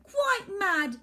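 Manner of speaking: usual speaking voice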